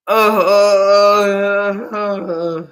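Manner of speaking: loud, broken crying uh-uh sounds; anguished whimpers. Real, not acted. Fan can't bear the tense at-bat, whimpering in anguish. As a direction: whimper in real sports agony. Fan in agony at big moment.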